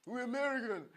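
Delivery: hammed-up American boast; mock American accent. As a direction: play a proud American, lightly. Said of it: "like Americans do".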